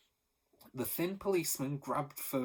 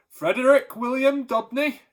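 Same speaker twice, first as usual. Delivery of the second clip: much louder and higher, a formal call; loud official voice; 12 semitones higher, 14 dB louder than usual. A narrator's reading. Policeman at the door calling Pa, loud official voice. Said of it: "a loud official voice".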